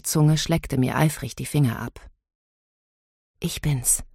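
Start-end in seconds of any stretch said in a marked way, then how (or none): 3.4-4.0 quiet, hushed announcement; soft-voiced reassurance; softly. A narrator's reading. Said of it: "sagte ich leise".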